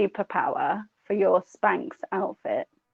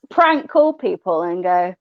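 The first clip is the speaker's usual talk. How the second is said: usual speaking voice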